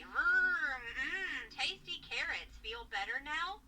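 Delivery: put-on slow cooing baby talk; airplane spoon game. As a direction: coo it like feeding a toddler. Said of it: "feed you "Here Comes the Airplane Spoon".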